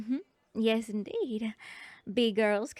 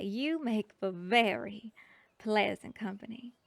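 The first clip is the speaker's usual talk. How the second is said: normal talking voice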